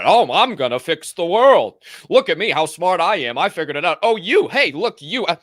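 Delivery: loud, high-pitched mock do-gooder; mocking know-it-all. Mocking people on their high horse, loud preachy know-it-all. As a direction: puff it up like a self-important show-off. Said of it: "ride in on your high horse".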